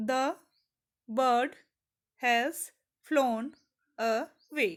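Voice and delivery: each word set apart by a pause; word-by-word reading. Shown as a demonstration. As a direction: read "the bird has flown away" one piece at a time. Splits example line into syllables.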